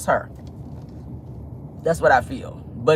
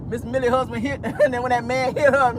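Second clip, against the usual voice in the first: usual speaking voice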